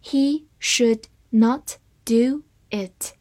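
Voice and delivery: slow, one word at a time; deliberate, spaced-out model reading; slow read-through. Shown as a demonstration. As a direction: read it slowly with clear gaps between words. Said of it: "好，慢读一遍".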